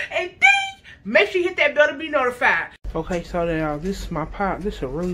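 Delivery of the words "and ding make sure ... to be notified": high, loud, excited youtuber greeting; hyped vlog intro. Acted. Host greeting her viewers, high and excited. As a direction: pump up the viewers like a hype host.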